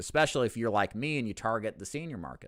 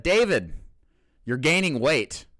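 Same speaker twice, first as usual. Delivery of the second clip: loud, higher-pitched quoted jab; mock blunt call-out; 6 semitones higher, 7 dB louder, slower than usual. Reading a viewer's comment about his weight, mock blunt call-out. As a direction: call it out loud, stretching the words.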